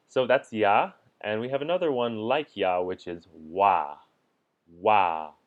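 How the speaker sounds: ordinary talk, nothing marked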